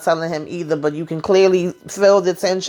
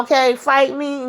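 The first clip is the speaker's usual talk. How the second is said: mock challenge; 8 semitones higher than usual. Mock catfight challenge after meow.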